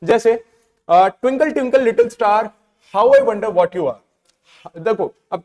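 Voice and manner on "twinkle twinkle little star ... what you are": poem recital. Shown as a demonstration. Recites poem lines slowly.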